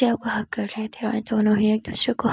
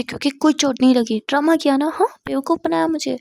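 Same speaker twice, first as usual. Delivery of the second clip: scolding; 4 semitones higher, 6 dB louder than usual. Acted. Jia scolds Misha for faking.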